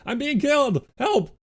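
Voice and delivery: high mock yell for help; hammed-up distress, high; playful yell as the cat bites; mock cry for help. Cat attacking him.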